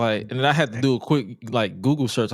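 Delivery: ordinary talk, nothing marked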